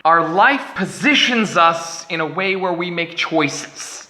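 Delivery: ordinary delivery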